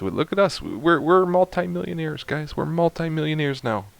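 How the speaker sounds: high-pitched, giddy celebration; real, gleeful excitement; excited. Real, not acted. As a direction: crow about sudden riches, voice pitched well up. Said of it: "sorry, I'm excited".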